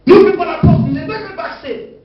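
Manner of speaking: high loud command; preacher shouting down the enemy; forceful rebuke. Real, not acted. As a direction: slam the door on it.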